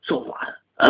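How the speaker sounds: whispered aside, breathy and secretive; a hushed hint of trouble. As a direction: let it slip in a conspiratorial whisper. Hinting at hidden problem.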